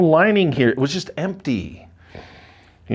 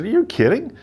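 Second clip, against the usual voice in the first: surprised; 11 semitones higher than usual. Retold or imitated. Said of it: "I said".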